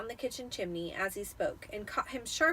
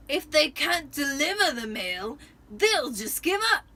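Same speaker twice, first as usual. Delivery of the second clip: loud, higher gruff uncle; Uncle Vernon voice; 5 semitones higher, 8 dB louder than usual. A narrator's reading. Vernon explaining to Petunia, mouth full of nails, blustering. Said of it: "he explained to Aunt Petunia".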